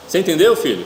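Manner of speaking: parent asking. Retold or imitated. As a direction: call to a distracted kid. Parent asking distracted child.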